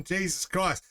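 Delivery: ordinary delivery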